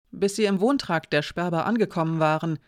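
ordinary delivery, nothing marked

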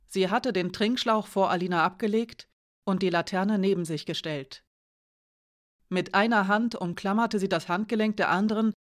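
ordinary delivery, nothing marked

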